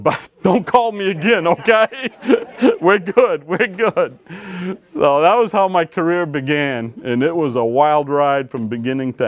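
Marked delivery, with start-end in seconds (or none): 0.1-4.2 laughing. Retold or imitated. Joking dismissal with laughter.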